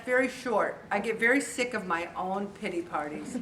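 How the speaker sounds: ordinary delivery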